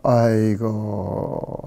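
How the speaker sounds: long, low sighed lament; slow groan of self-reproach; rueful sigh. Retold or imitated. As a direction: draw out a low, soft "oh dear". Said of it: "고민하다가".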